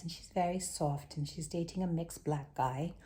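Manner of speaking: a hint of a hushed posh heiress; soft rich aristocrat. Showing how Naomi Watts' character talks, softly imitated. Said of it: "and she talks like this".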